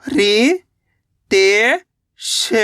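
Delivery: very slow, each syllable drawn apart. Shown as a demonstration. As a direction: spell it out slowly, piece by piece. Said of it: "तो मिलके बन जाएगा रितेश". (अक्षर-अक्षर धीरे-धीरे)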